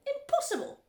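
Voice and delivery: high, loud exclamation; crying out. A narrator's reading. Factory owner rejecting marshmallow idea, crying out. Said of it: "cried Mister Wonka".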